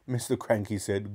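ordinary delivery

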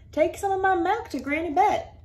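lightly voiced dairy farmer; friendly farmer. Carl continues.

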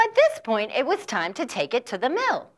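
ordinary delivery, nothing marked

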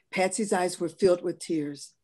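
ordinary delivery, nothing marked

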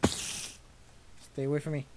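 slow, half-whispered plea; fearful hush. Real, not acted. Player warding off mobs, hushed and scared. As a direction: murmur it softly and slowly.

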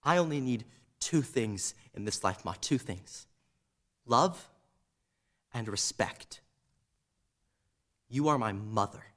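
very low, hushed and measured; quiet ultimatum. Acted. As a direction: lay down terms softly, with long pauses.

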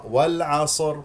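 "والعصر" split as wal-'a-sor; mistaken voweled ص. Shown as a demonstration. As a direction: add a wrong vowel after ص in "والعصر". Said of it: "يحرك الصاد". (نطق "والعصر" خطأً بصاد متحركة)

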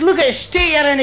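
a hint of a scandalised constable; incredulous policeman.

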